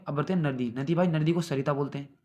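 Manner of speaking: ordinary talk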